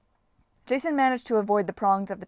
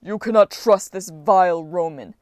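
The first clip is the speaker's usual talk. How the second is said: loud, indignant giant; booming denunciation; bellow; 2 semitones lower, 7 dB louder than usual. A narrator's reading. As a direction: roar the warning with disgust. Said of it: "the giant bellowed".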